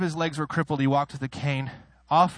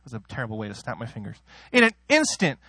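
ordinary delivery

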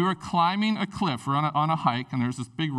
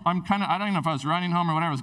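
ordinary delivery

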